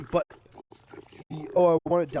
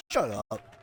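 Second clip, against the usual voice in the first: ordinary delivery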